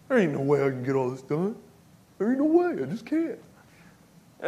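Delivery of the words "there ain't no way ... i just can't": defeated self-talk. Retold or imitated. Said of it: "just sat there and just wallowed".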